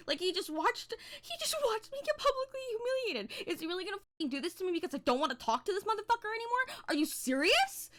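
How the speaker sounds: very high-pitched, distraught incredulity; high, worked-up protest at being humiliated; outraged disbelief. Retold or imitated. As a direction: wail it in mock-hysterical outrage.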